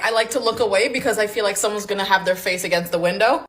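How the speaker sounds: ordinary talk, nothing marked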